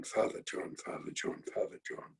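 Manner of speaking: whispering the priest's name; hushed whisper. Retold or imitated. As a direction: breathe it out, hushing the child. Mother hushing child about priest.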